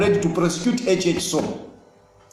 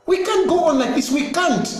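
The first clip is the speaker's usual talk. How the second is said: urgent higher echo of the line; higher insistent repeat; 8 semitones higher than usual. Real, not acted. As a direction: say "We can't go on like this" again, pitched much higher, "can't" stressed.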